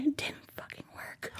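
hushed, whispery disbelief; dismayed hush. Real, not acted. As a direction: drop to a near whisper in dismay. Dismayed retelling of failed stand.

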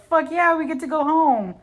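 high-pitched, hammed-up cheer; mock jubilation. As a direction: celebrate like relieved troops, pitched well up. Retold soldiers rejoice at going home.

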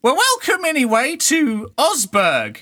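high, loud cheeky urchin; cheerful kid greeting; street kid voice. Acted.

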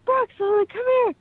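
high, loud yell for help; screaming for a coworker from the cockpit floor; retold scream. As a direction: call out to him in a panic. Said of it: "and I'm screaming".

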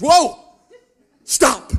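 sharp loud alarmed yell to halt someone; sudden shout. Retold or imitated. Customs agent stopping a traveller opening his bag, loud alarmed shout. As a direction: bark it out like a startled guard.

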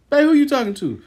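high-pitched nosy call-out. Retold or imitated. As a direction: call out to find out who's on the phone. Acted nosy husband interrupting her call.

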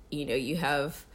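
ordinary talk, nothing marked

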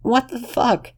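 very high shocked yelp; shocked cry of pain. Acted. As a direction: shriek it after being shot. Lexington shot in the foot.

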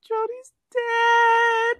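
high, drawn-out mock wail; imitated crying. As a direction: wail it slowly like a crying little sister. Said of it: "silent crying. She's like".

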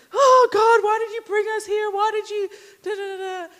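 high, loud, tearful wail to God; crying out to God. Retold or imitated. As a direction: break into a sobbing plea. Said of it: "tears, and, and he's like".

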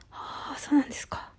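whispered, taken-aback repeat; soft breath of surprise; hushed reaction. Real, not acted. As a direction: say it almost voicelessly.

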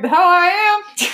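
loud scoffing denial; loud retort. Retold or imitated. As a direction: scoff it back loudly. Retort quoted.

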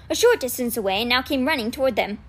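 ordinary delivery, nothing marked

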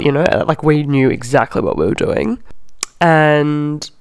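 ordinary talk

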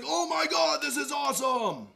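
high, loud delighted shout; delighted guitarist. Retold or imitated. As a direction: voice the guitarist's relief as his neck loosens.

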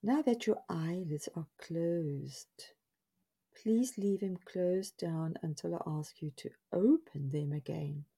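measured guided-relaxation voice with long pauses; guided body scan. Acted. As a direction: lead them step by step, leaving space after each instruction. Leads listeners through guided body scan.

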